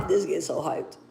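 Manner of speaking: low, soft, settling down; calming down. Real, not acted. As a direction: bring it down to a murmur. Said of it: "let me calm down".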